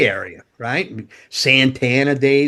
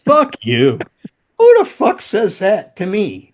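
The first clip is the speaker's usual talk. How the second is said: inner indignation; 7 semitones higher, 5 dB louder, slower than usual. Retold or imitated. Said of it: "You know, like".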